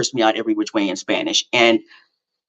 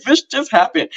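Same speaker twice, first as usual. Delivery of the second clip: high, wailing mock sob; imitating her own sobbing phone call to her daughter; mock boo-hooing; 12 semitones higher than usual. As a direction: replay the tearful call, half crying. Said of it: "just, like, boo-hooing".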